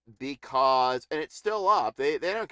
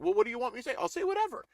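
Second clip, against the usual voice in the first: high, bewildered shrugging voice; mock Tucker Carlson whine; 6 semitones higher, much faster than usual. Streamer mocking Tucker Carlson, high and careless. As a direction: toss it off like you don't care. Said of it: "he's like".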